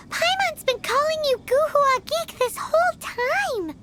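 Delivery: ordinary talk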